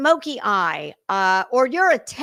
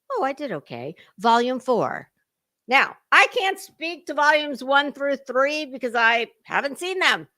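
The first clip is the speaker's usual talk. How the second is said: normal talking voice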